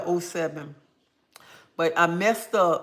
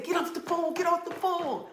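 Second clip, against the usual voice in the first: urgent plea; 13 semitones higher than usual. Retold or imitated. Panicked plea to husband.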